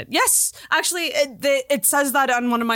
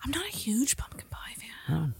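hushed, unvoiced admission; whispered confession; 11 dB quieter than usual. Real, not acted. To the host who bakes pumpkin pie, a whispered confession. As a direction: whisper it like a guilty secret.

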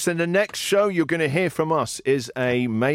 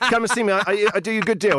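quick, higher put-on Russian accent; 6 semitones higher, faster than usual. Host playing a fighter selling watches, hammed-up Russian accent. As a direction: rattle off a sales pitch in bad Russian accent. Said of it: "I'm doing a Russian accent".

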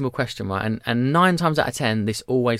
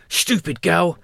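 imitating a sleepy toddler, higher voice; higher, childlike mumble of a dreaming toddler; 5 semitones higher, much slower than usual. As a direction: mimic your three-year-old talking in his sleep. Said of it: "He went".

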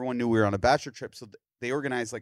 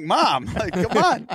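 exasperated protest; 9 semitones higher, 8 dB louder, slower than usual. Retold or imitated. Retold protest at mom changing subject.

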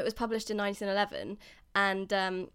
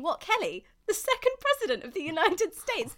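higher mock-incredulous question; imagined baffled listeners; 8 semitones higher than usual. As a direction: voice the audience's puzzled reaction, pitched up. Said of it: "I know what you're all thinking".

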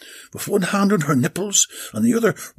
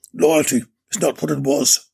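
ordinary delivery

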